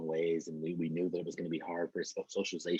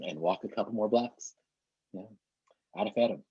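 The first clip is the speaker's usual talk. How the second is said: ordinary talk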